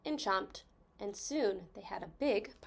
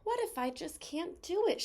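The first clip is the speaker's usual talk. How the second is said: higher-pitched, self-doubting question; worried wondering; 8 semitones higher than usual. A narrator's reading. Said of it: "she wondered".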